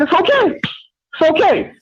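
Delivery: put-on high, drawn-out reassurance like calming a child; mock soothing. Caller teasing rival fans, cooing mock comfort. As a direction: coo it slowly to settle them down.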